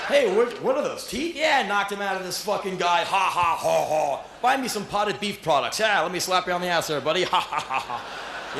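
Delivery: cocky high boasting bro with mock laughs; tough guy. Retelling his stage bravado, hammed-up cocky tough guy. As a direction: brag like a swaggering macho idiot. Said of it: "tough guy".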